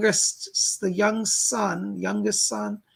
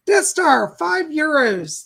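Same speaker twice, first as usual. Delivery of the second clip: loud, high donation shout-out; excited announcement; 7 semitones higher, 7 dB louder, slower than usual. Acted. Thanking a viewer for a donation, loud cheerful call-out. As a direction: call out the donor with gusto.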